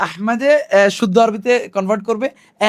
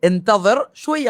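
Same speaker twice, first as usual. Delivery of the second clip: slow demo; much slower than usual. Shown as a demonstration. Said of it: "আমাদের সাথে বলুন".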